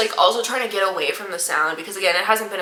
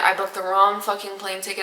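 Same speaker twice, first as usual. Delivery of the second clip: usual speaking voice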